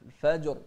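الفجر with the ج bounced with an echo; قلقلة الجيم في الفجر; qalqala on the jim. Shown as a demonstration. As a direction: pop the ج in الفجر. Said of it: "نفس الشيء الجيم".

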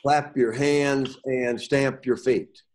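slow, deliberate reading; slow demo line; slow example read. Shown as a demonstration. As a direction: read it out slowly, each word weighted. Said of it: "here's an example".

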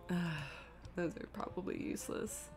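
normal talking voice

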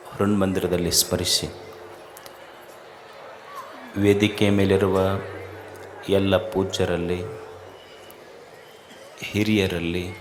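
low, soft, measured salutation in short phrases; hushed, level-pitched formal opening prayer; solemn invocation. Real, not acted. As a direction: open reverently, low and quiet, with long pauses. Opens discourse with invocation and salutation.

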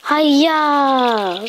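high shouted battle cry; karate yell. Pretend.

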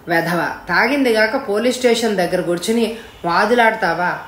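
plain reading voice

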